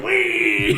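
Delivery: high laughing whee; a crow enjoying the ride; gleeful wee. Acted. GM voices crow gliding.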